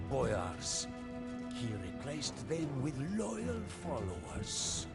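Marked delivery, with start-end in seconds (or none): none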